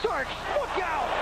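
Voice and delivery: sudden high warning cry; excited call. Real, not acted. Commentator warning as Starks is taken out, excited. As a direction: yell a warning as the player gets hit. Live call of a hard foul.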